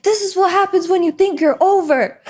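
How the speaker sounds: mock scolding scream. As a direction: lecture her angrily as you storm out. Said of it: "starts screaming".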